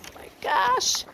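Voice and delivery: high breathless whisper of amazement; whispered awe. Real, not acted. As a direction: breathe it out in disbelief. Awestruck at frog.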